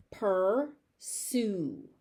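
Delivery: "Pursue" split into pur, sue with a gap; syllable by syllable. Shown as a demonstration. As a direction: say "Pursue" slowly in two beats. Teacher breaks word into syllables.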